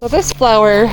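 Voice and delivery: usual speaking voice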